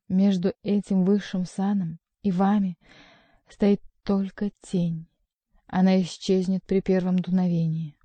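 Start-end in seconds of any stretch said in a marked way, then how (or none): none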